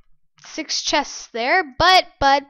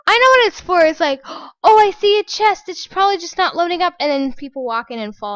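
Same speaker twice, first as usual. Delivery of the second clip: excited; 3 semitones higher, 9 dB louder, faster than usual. Real, not acted. Excited explaining.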